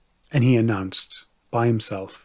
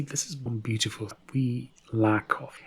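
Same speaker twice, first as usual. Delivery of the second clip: whisper; 6 dB quieter than usual. Real, not acted. Teller aside, drops to whisper.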